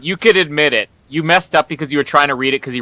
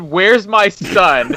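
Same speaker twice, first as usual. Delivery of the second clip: drawn-out, raised dramatic demand; slow, higher, melodramatic quote; Mel Gibson; 4 semitones higher, much slower than usual. Acted. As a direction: stretch it like a movie father's ransom plea. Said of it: "how I read that".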